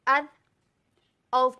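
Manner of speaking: अज thin with an a sound, औ heavy with an o sound; बारीक अज, मोटा औ; thin then heavy. Shown as a demonstration. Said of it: "बारीक पढ़ते हैं तो आ की साउंड आती है".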